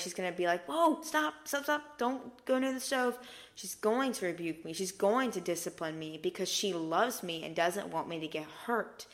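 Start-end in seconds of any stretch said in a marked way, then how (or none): 0.7-3.2 high, urgent warning; mock-alarmed parent stopping a child; mom raising her voice. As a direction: cry out a higher-pitched warning, not a full shout. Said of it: "She's gonna maybe raise her voice".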